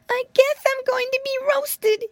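very high, despairing piglet cry; a doomed little pig wailing. Acted. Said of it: "he cried".

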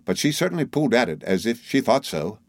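usual speaking voice

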